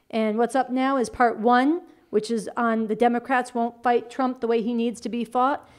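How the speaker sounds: normal talking voice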